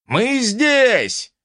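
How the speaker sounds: high, drawn-out call; distant yell. A narrator's reading. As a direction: holler from the next street. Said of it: "донёсся с соседней улицы вопль". (вопль издалека)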